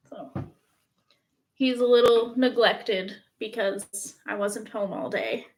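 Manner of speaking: ordinary talk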